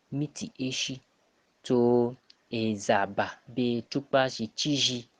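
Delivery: ordinary talk, nothing marked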